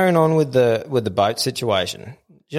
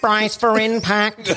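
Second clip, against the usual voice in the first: higher, slow mock crew warning; crew announcement; 9 semitones higher, much slower than usual. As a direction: play the crew calling it out, drawn out. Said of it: "they go".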